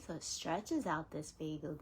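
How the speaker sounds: normal talking voice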